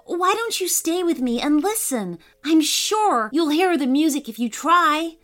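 put-on bright, high childlike voice; coaxing little girl, lifted up. Poppy speaking.

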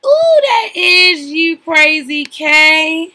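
high, loud, drawn-out whoop; excited exclamation. Real, not acted. Telling Crazy K the lizard symbol is her, excited exclamation. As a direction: crow it out slowly, stretching each word. Gleeful reaction to a matching meaning.

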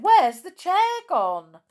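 high, loud, drawn-out question; startled surprise. Acted. Peter noticing the empty playroom spot, surprised. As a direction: ask it loudly, a little scared. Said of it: "looked rather surprised and a little".

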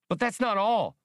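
high, loud excited outburst; Max, blurting. A narrator's reading. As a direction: blurt it out in a little computer's piping voice. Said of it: "Max blurted".

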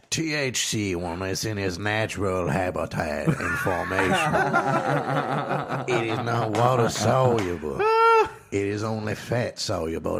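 slow laughing bayou drawl; Swamp [name] voice. Pretend. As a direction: explain it like a swamp villain. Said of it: "turns into Swamp [name] explains it".

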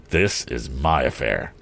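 low, slow, controlled anger; angry. A narrator's reading. Morse claims the fight as his, angry. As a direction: weigh each word with understated anger. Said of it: "now unreasoningly angry".

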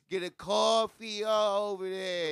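loud, slow mock Italian; loud hammed-up Italian accent, mocking a friend. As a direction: ham up a drunk Italian guy. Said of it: "He's gonna start talking like this".

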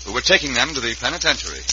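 usual speaking voice

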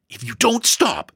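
put-on high-pitched girlish threat; almost desperate. A narrator's reading. Sister to her teasing brother, almost desperate. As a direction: warn him at the end of your tether. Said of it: "said the almost desperate girl".

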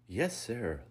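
high, eager reply; beaming. A narrator's reading. Lucas answering an order, beaming. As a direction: beam through the words. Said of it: "Lucas beamed".

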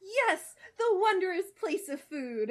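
high, loud, slow joyful exclamation; excited Starfire. Acted. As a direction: gush with overjoyed delight. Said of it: "Starfire barked".

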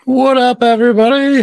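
big, high, loud hello to the crowd; real hyped-up shout; shouted greeting. Real, not acted. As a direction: holler it out to open the show. Host's opening greeting to stream.

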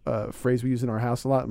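ordinary delivery

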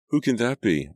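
startled cry. A narrator's reading. Girl jumps up from bed, startled by the ring, crying out. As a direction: voice jumps high and loud in alarm. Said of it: "I cried".

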